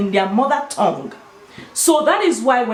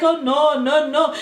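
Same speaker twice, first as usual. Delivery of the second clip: imitating a French guy; 5 semitones higher than usual. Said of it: "He was like".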